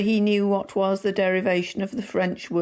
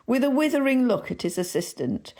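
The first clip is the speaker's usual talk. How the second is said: ordinary delivery, nothing marked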